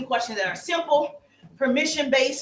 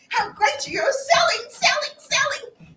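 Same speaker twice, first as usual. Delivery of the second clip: rising excitement; 11 semitones higher than usual. Real, not acted. Coach driving home that everyone sells, climbing high. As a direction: voice climbs much higher on each selling.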